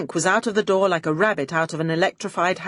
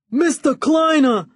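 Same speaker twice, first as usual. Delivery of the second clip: loud, high call across the room; 9 semitones higher, 6 dB louder than usual. Acted. Henchman calling to his boss across the room, loud high call. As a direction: shout his boss's name from the door.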